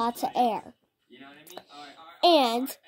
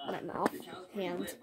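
ordinary talk, nothing marked

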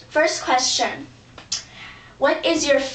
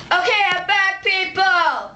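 announcing a return; 6 semitones higher than usual. Acted. Announcing return from backstage search.

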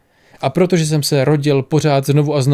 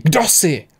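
emphatic, slightly raised KDO JSI; crying out; 3 semitones higher than usual. A narrator's reading. Mnemosiné hits the wall demanding his identity, crying out. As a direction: demand it with mild force. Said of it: "křikla na něj a udeřila rukou do zdi".

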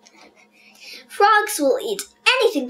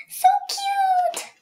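1 gushing. Real, not acted. Gushing over tiny frog.

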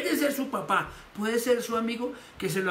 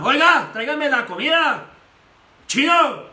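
loud, higher harsh command; rude husband; 6 semitones higher, 12 dB louder than usual. Retold or imitated. Husband demanding food from his wife, loud and rude. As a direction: bark the order at your wife.

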